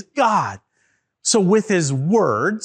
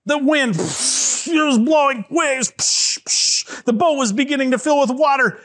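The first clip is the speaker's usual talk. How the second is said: higher, urgent storytelling; excited storm telling; 7 semitones higher than usual. A narrator's reading. Telling children of the blowing wind and waves, excited. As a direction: build the drama of the storm.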